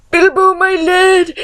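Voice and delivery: high, drawn-out call to a lad; high-pitched, slow character voice; hobbit greeting. Acted. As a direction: act out a hobbit hailing someone, slowly. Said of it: "And Frodo's like".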